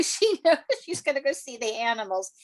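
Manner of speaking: ordinary talk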